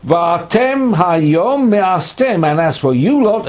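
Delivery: "Va'atem" and "you" high, loud and accusing; emphasis on YOU. Acted. God accusing Israel of rejection, "Va'atem" and "you" stressed. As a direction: drag out "Va'atem", then punch "you lot". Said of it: "again emphasis".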